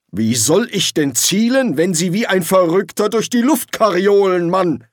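indignant, high-pitched complaint; angry retort. A narrator's reading. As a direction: snap back crossly, pitch climbing. Angry retort on lurching broom.

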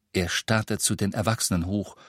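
plain reading voice

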